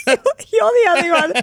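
cracking up mid-sentence, pitch shooting up; helpless giggle breaking through the words; real laughing. Real, not acted. Traveller retelling her driver's bad news, laughing.

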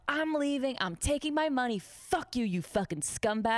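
high-pitched, defiant retold tirade; angry quitting. Waitress quitting and cursing her boss, retold anger. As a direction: tell the boss off, acted out.

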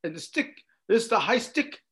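put-on high, Canadian accent explaining penalties; lightly put-on Canadian accent, casual; Canadian goalie accent. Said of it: "Canadian accent".

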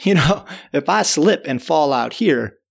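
ordinary delivery

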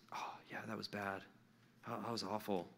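hushed, mopey regret, mostly breath; imitating a downcast sinner's whisper; mock sorrowful whisper. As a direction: sigh it out like a guilty kid.

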